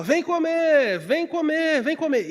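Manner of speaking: put-on high, loud mother calling the kids to eat from the doorway. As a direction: sing out vem comer like a mum at the back door. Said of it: "minha mãe abria a porta e falava".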